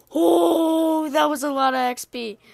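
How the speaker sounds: long, loud "oh" of amazement; amazed. Real, not acted. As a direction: marvel at a big reward. Lots of experience drops.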